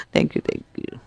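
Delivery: soft whispered thank you, thank you. Real, not acted. Tarot reader quietly thanking after a sign, whispered. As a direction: breathe the thanks under your breath.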